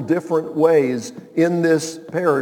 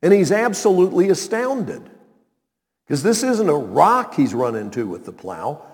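normal talking voice

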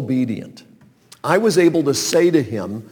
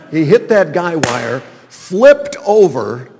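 dramatic; 4 semitones higher, 8 dB louder, slower than usual. Real, not acted. Dramatic climax of story.

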